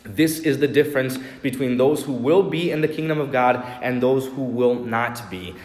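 normal talking voice

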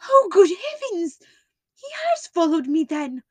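high, loud gasp of alarm; frightened cry. Acted. Miss Stoner cries out in fear that the doctor followed her. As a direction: cry out in sudden dread.

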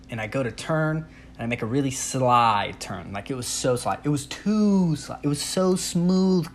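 ordinary talk, nothing marked

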